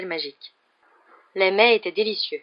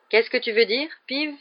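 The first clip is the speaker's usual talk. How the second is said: ordinary delivery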